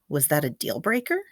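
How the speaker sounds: usual speaking voice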